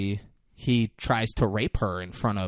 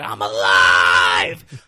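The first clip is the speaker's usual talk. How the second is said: slow, louder, drawn-out shout of triumph; triumphant shout; 4 semitones lower, 11 dB louder, much slower than usual. Retold or imitated. The hitman shouts to the cheering crowd, slow triumphant bellow.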